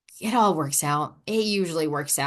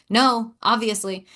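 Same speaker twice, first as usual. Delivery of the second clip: slow, sassy retort; 3 semitones higher, 3 dB louder, slower than usual. Retold or imitated. Client retorting to a mentor's challenge, sassy. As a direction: draw it out with mock sass. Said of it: "I'm very sassy".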